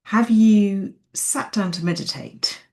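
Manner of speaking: ordinary talk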